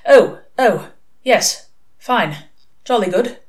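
hurried flustered brush-off. A narrator's reading. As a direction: fluster and hurry it out. Flustered angel hurrying inside.

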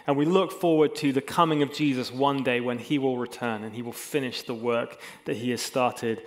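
usual speaking voice

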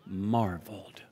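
near-whispered, reverent hush; soft, low, awed murmur. Real, not acted. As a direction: let the word drop almost to a whisper. Hushed word for congregation to repeat.

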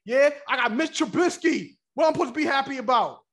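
ordinary delivery

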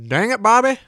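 high, loud cartoon dad exclamation; King of the Hill impression. Quoting the cartoon to a fellow fan, high loud impression. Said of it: "King of the Hill".

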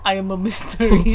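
mysterious fake Russian; badly faked Russian accent; mock Russian accent. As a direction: play a shadowy Russian stranger. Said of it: "the only accent I can do".

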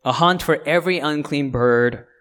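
loud, emphatic reading of scripture; preacher reading Revelation aloud. Acted. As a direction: proclaim the verses with weight.

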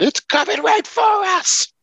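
high, drawn-out mock alarm cry; mock panic. Co-host introducing himself with a disaster-movie line, mock panic. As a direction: cry out a hammed-up disaster warning.

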